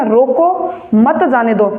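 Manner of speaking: slow, with a break after "रोको", then "मत जाने दो" together; pause after रोको. Shown as a demonstration. Teacher showing the comma means stop someone, pause after "रोको". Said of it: "किसी को रोकने के लिए बोला".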